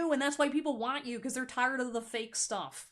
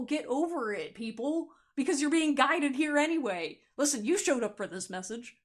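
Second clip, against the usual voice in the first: normal talking voice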